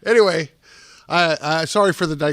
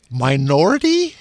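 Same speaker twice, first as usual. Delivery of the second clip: normal talking voice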